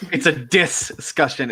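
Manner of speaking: "discussion" split, with DIS drawn out and punched; stress on DIS. Shown as a demonstration. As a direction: lean slowly on the DIS of "discussion". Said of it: "It's just insulting me".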